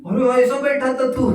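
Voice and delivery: mock angry retort. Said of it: "उनकी आवाज देखो".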